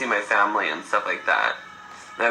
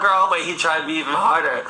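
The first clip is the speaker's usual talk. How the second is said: ordinary delivery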